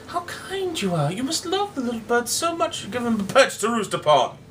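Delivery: bow and sound sweetly gracious, half-mocking.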